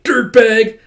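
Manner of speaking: loud shouted name-calling; angry insult. Acted. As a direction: spit the insult. Alma insults Tatsuomi.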